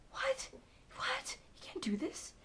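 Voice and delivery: real disbelief at the plot twist; shocked disbelief. Real, not acted. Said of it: "I don't know what's going on anymore".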